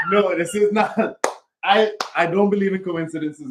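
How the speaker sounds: high, laughing protest; laughing disbelief. Real, not acted.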